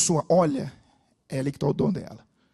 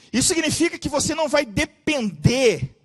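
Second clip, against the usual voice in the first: raised pitch, louder proclamation; preaching at full force; 6 semitones higher, 8 dB louder than usual. Real, not acted. Preacher proclaiming to the church, loud and raised. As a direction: proclaim it with fire.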